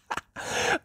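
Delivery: light, rapid acted giggle. A narrator's reading. Once-miserable character's chuckle grows, light giggle. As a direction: let a little giggle bubble out. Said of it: "which turned into a giggle".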